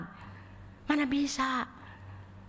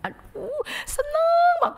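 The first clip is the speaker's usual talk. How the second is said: much higher, louder happy squeal; delighted squeal; 8 dB louder, slower than usual. Acted. Maria overjoyed at Jesus' feet, a high delighted squeal.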